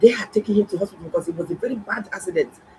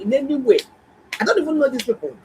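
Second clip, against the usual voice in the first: higher and louder, stress on any; insistent denial; 7 semitones higher, 5 dB louder, faster than usual. Real, not acted. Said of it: "insisting not related".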